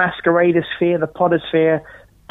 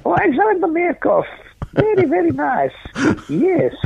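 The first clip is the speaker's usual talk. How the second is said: put-on much higher Russian meerkat accent voice; high squeaky Russian accent like the meerkat ad; 13 semitones higher than usual. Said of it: "Meerkats, with the Russian accent".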